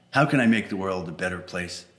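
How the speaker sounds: ordinary delivery, nothing marked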